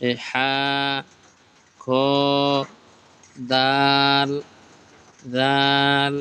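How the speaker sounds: letter names stretched long, one by one; slow, stretched Arabic letter names; drawn-out recitation. Shown as a demonstration. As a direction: draw out each letter name like a Quran teacher. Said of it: "আমার সাথে এভাবে টেনে টেনে পড়বেন".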